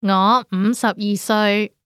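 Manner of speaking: ordinary talk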